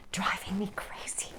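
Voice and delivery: whispered complaint, off to the side; real exasperated whisper; whispered aside. Real, not acted. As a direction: hush it like he might hear. Aside complaining about her husband.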